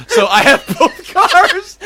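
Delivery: high, drawn-out words breaking into laughter; laughing. Real, not acted. Telling friend both car alarms went off, laughing hard. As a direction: squeal it out through helpless laughter.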